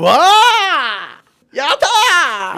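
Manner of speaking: 高い声ではしゃぐ; 嬉しさを爆発させて; 大喜び. Real, not acted. 告白に大喜びする. (大喜びの歓声)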